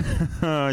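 short amused laugh. Real, not acted. Laughing at the trailer.

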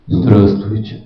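very low, heavy greeting; deep bass voice. Shown as a demonstration. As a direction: say it deep and oak-solid. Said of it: "Они вот так говорят". (очень низкий басовитый голос)

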